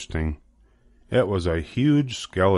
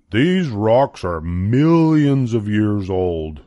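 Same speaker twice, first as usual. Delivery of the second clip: slow, louder teacher voice; lecturing teacher; 3 dB louder, slower than usual. Acted. Teacher explaining the rocks, slow lecturing voice. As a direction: explain it slowly like a patient teacher.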